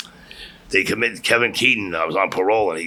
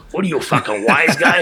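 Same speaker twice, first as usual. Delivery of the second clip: higher, mildly heated cop imitation; retold irritated challenge; 6 semitones higher, 4 dB louder than usual. Retold cop challenges the wise answer.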